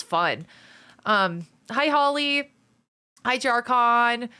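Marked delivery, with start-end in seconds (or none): none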